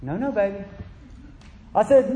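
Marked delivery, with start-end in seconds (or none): 0.0-0.6 softer, tender mock reassurance; gentle. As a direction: coo it gently like to a baby. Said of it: "a real gentle".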